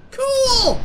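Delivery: loud, high, drawn-out delight; excited cheer. Real, not acted. Player thrilled to find a pirate, excited cheer.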